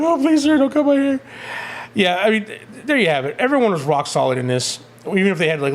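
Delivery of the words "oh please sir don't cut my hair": high, hammed-up begging; mock pleading. Retelling Thor's plea to the barber, mock pleading. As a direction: drop all defiance and beg in a squeaky voice. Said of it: "he's all defiant at first, and he's like".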